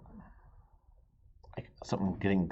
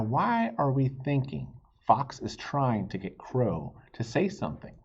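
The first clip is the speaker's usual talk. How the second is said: plain reading voice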